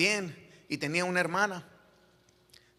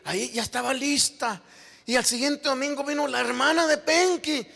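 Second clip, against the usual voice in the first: raised pitch, building to a loud delivery; impassioned preaching; 9 semitones higher, 6 dB louder than usual. Real, not acted. Preacher telling the congregation of a family's conversion, impassioned preaching. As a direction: preach the testimony with mounting fervour.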